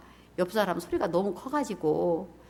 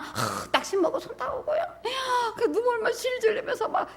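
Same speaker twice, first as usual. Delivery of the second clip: mock whimper; 13 semitones higher than usual. Mocking a self-pitying whiner.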